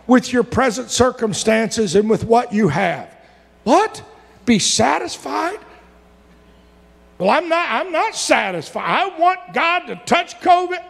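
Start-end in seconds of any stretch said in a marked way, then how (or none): 3.6-5.6 high, startled echo of the verse; incredulous. Acted. Preacher balks at the verse. 7.2-10.8 complaining believer. Acted. Voices a discontented believer.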